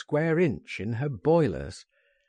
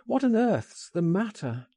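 high, insistent questioning; alarmed cry; 8 semitones higher than usual. A narrator's reading. Narrator to his shaking friend, crying out in alarm. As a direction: demand to know what is wrong. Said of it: "I cried".

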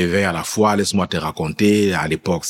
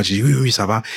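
usual speaking voice

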